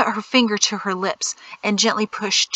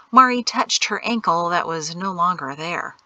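usual speaking voice

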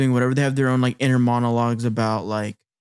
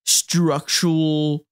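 very slow, stretched-out word; drawn out; 2 semitones higher, much slower than usual. Real, not acted. Describing Lloyd's mind, slow stretched "structural".

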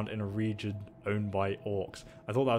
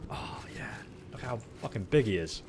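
whisper; 6 dB quieter than usual. Real, not acted. Hushed reaction to huge enemy.

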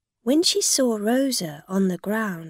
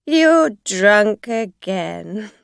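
taunting; 9 dB louder, much slower than usual. A narrator's reading. Rosa taunting the drunk driver.

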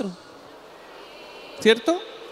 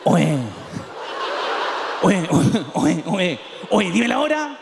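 lower, insistent calling; shady robber; 5 semitones lower, slower than usual. Acted. Robber stops a man near home, pleading lowly. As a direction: sidle up asking the time. Old-time robber asking the time.

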